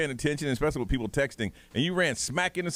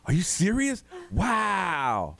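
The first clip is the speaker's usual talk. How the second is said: high, overdone mock amazement; fake enthusiasm; 5 semitones higher, much slower than usual. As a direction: ham up the wow to humour the kid. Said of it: "I'm just pretending like crazy".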